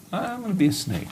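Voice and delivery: a silly, high little snake talking to himself; mock snake voice. Preacher voicing the snake's morning thought, high mock voice.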